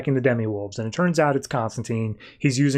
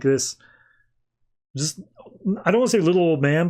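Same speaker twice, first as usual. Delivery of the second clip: normal talking voice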